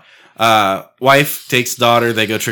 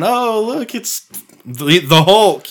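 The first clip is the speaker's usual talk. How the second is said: high, slow fake cheerful voice; fake cheerful greeting; 8 semitones higher, much slower than usual. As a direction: sound overjoyed but put on. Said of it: "and I smile".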